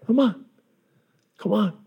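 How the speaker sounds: high, insistent waving-in; eager high-pitched call; Jesus beckoning. Acted. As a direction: urge hesitant families to come closer. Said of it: "Jesus says".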